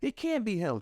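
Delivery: high, scoffing disbelief; doubting crowd. Retold or imitated. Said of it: "They go".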